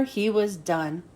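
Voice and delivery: ordinary delivery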